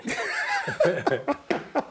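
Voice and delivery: high cackling laugh, real; big laugh. Real, not acted. Cracks up at his friend's saying, real high laugh. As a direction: crack up helplessly, high-pitched.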